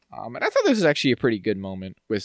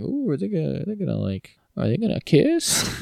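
teasing gossip; 6 semitones higher than usual. Retold or imitated. Friend teasing about the couple, giddy high voice.

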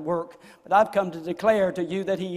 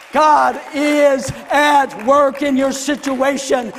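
full-force preaching; 8 semitones higher, 11 dB louder than usual. Real, not acted. Preacher rousing the congregation that God never stops, full-force shout. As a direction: cry it out to rouse the congregation.

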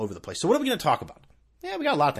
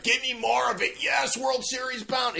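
high, shouted overhyped fan; mocking Yankee fans; 11 semitones higher, slower than usual. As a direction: ham up a fan screaming for joy. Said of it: "fucking reactions from people".

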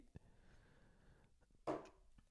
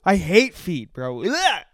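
high, worked-up rant of revulsion; hammed-up disgust; 5 semitones higher, 5 dB louder than usual. Comic telling co-host he hates feet, hammed-up disgust. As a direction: push it away, grossed out.

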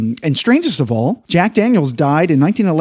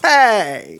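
1930s beat cop; 6 semitones higher than usual. Pretend. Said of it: "talk like this in the movies".

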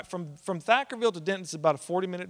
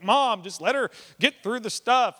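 loud, raised imitation of an impatient dad; exasperated son; 7 semitones higher, 8 dB louder than usual. Dad telling his mother to let the nurse work, exasperated. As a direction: plead over her, stressing each beat.

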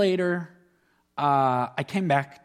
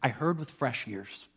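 whispered; 3 semitones lower, 4 dB quieter than usual. Real, not acted. Speaker whispers a personal revelation to the congregation. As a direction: breathe it out like a secret realization. Quiet reflective moment in sermon.

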